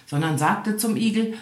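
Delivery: ordinary delivery, nothing marked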